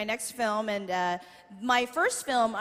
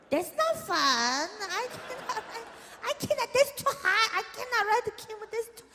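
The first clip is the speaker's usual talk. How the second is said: very high, softer mock whimper; mom's frightened whine; 9 semitones higher, 6 dB quieter than usual. Mom refusing to ride the camel, hammed-up whimper. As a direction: plead like a scared spoiled mother.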